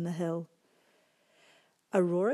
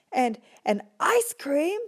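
excited; 8 semitones higher, 7 dB louder, slower than usual. Acted. Pigs eager.

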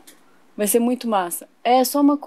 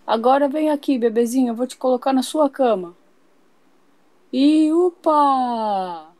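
ordinary talk

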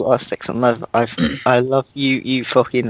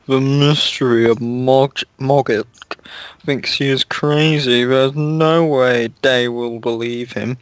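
mean villain voice. Acted. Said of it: "Snoop laughed meanly".

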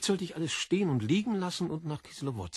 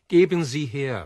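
much louder, insisting hard; impatient demand; 10 dB louder than usual. Acted. Berlioz to the kiosk woman, a loud impatient demand. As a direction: repeat the order like you've lost patience.

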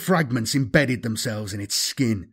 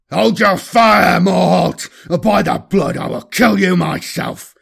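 furious shout; 5 semitones higher, 5 dB louder than usual. Acted. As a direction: roar the threat at him. Furious threat over the vox.

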